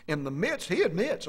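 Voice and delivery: normal talking voice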